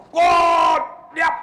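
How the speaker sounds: sudden high, loud parade-ground shout; warrant officer's command. Retold or imitated. Warrant officer drilling troops on the square, shouted command. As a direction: bellow it like a drill order. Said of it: "Dia cakap macam pegawai waran".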